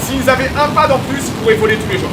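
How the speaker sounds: loud, high, thrilled; excited. Real, not acted. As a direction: cheer it on as the rocket climbs. Cheering live launch footage.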